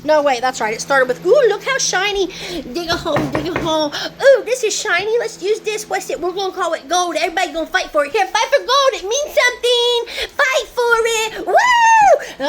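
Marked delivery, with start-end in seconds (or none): 1.3-10.3 high, giddy voice of the people who invented gold; a hammed-up wide-eyed schemer, higher; mocking greedy inventor. Mocking the inventors of money, giddy and high. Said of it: "somebody could be like". 10.4-12.5 high, loud mock battle cry; mock rally shout. Mocking the inventors of money urging a fight, loud mock cheer. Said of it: "somebody could be like".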